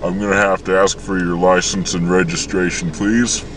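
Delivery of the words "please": ordinary talk, nothing marked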